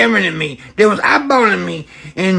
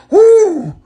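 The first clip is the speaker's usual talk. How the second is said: startled ooh; 11 semitones higher, 4 dB louder than usual. Acted. Shocked by stranger's request.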